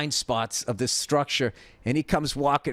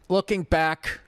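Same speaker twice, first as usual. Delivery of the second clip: ordinary delivery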